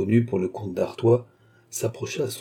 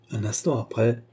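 plain reading voice

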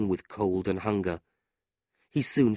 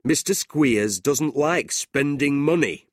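slow, higher hearty jibe; mocking big man; 6 semitones higher, 4 dB louder, slower than usual. A narrator's reading. John mocking Mister Squeers to Nicholas, jeering. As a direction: draw out the joke about the miser. John mocks the stingy schoolmaster.

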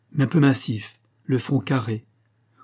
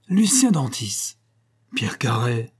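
surprised exclamation; slower than usual. Acted. Two old friends recognizing each other, surprised exclamations. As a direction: call the names out in happy disbelief. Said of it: "une double exclamation retentit".

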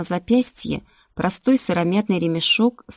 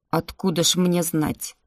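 plain reading voice

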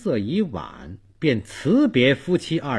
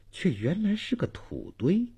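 ordinary delivery

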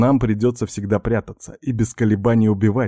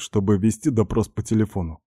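plain reading voice

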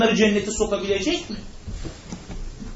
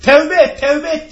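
loud, higher mock yell; retold shout; 7 semitones higher, 6 dB louder, slower than usual. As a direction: call out the warning sharply. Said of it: "diye bağırdı".

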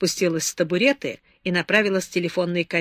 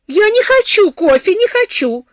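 shouted refusal; 13 semitones higher, 10 dB louder than usual. A narrator's reading. Drunk girl refuses coffee.

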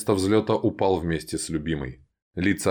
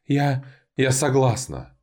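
broken consent; 6 semitones higher, slower than usual. Acted.

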